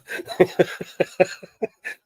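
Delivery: short airy laugh, mostly breath; breathy laugh. Real, not acted. Laughing at crying mid-story.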